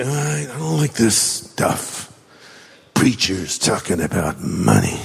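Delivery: mock a reluctant listener who hates money talk; grumbling churchgoer. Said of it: "Now we say".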